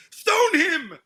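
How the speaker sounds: high, loud shout from the back; acted mob yell, high and sudden; yelled heckle. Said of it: "some guy at the back yells".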